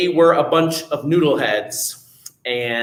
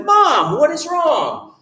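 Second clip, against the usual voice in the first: Jack calling out; 12 semitones higher, much slower than usual. Retold or imitated. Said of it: "And Jack said".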